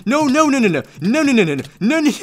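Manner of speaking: high, rapid-fire string of noes; loud, high mock alarm; playful panic. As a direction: yelp no over and over, half laughing. Attacked in game.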